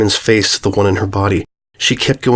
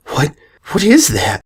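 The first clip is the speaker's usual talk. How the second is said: plain reading voice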